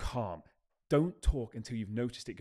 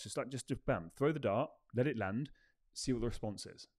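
ordinary delivery

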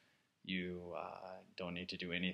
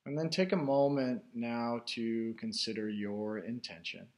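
guided meditation; 3 semitones higher, 5 dB louder than usual. Real, not acted. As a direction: guide listeners inward, unhurried, phrase by phrase. Leads a guided meditation after chat.

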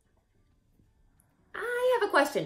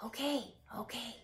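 fast hushed whisper; quick whispered okay, okay; 4 semitones lower, 14 dB quieter, faster than usual. Acted. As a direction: whisper it, told off. Whispering back after hush.

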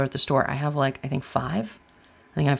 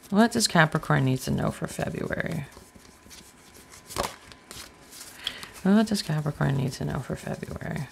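fading hushed question; 6 dB quieter than usual. Acted. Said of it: "repeated question to the deck".

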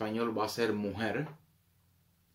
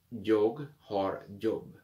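"Jag har jobb" again very slowly; slow repeat; much slower than usual. Shown as a demonstration. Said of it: "repeat slowly". (repetición lenta)

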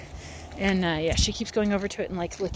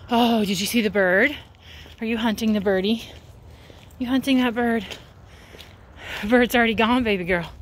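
excited dog talk; 2 semitones higher, 3 dB louder than usual. Real, not acted. Owner asking her dog where the birdie went, excitedly.

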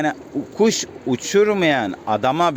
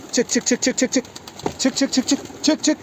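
rapid high-pitched calls climbing ever higher; high urging calls; 11 semitones higher than usual. Real, not acted. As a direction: chirp them out of the coop, voice rising. Calling birds to fly out. (kuşlara tiz sesle çık çık diye seslenme)

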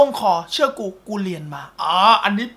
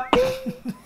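laugh; 12 semitones higher, 7 dB quieter than usual. Real, not acted. Reacting to a funny comment.

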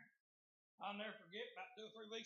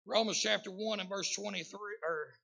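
loud, emphatic sermon delivery; full-force preaching; 16 dB louder than usual. Real, not acted.

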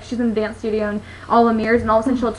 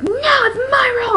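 mock possessive shriek; 13 semitones higher, slower than usual. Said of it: "and she's like".

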